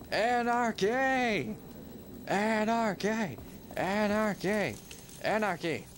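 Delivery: high, slow, hammed-up yell that fades each time; stretched chant of anarchy, winding down; drawn-out mock battle cry. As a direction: bellow it like a rioter, then let it die down. Repeated game cry, fading out.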